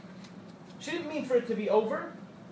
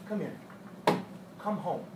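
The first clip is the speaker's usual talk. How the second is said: softer and lower, inviting; tender welcome; 4 semitones lower, 5 dB quieter than usual. Acted. The Rebbe welcoming his people home after Yom Kippur, soft. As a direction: call them home gently.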